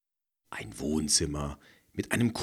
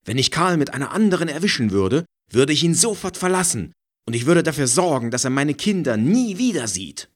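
heated; 10 semitones higher, 9 dB louder, faster than usual. A narrator's reading. Furious threats about cheating husband.

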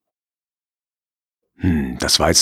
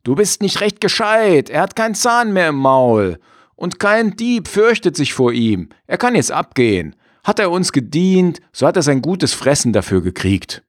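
raised, higher scornful farmer voice; gruff farmer; 10 semitones higher than usual. Acted. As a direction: brush her off as the farmer. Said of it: "sagt der Mann".

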